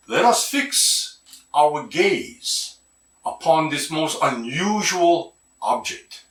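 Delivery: slow, grand, overly formal announcer voice; mock proper English. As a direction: put on a stiff proper-English voice to sound fancy. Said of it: "I never know I had for talk like this".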